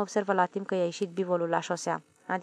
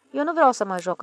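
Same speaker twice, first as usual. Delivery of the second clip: higher, louder pouting protest; raised, huffy pout; sulky refusal; 5 semitones higher, 6 dB louder than usual. A narrator's reading. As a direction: refuse like a sulking child. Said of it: "răspunse el bosunflat".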